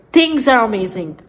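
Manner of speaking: ordinary talk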